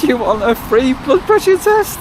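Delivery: high, slow mock-chirpy offer; mimicking the girl. Vlogger mimicking the chemist girl's offer in a high chirpy voice. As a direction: parrot her bright sales offer. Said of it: "just turned round to me and said".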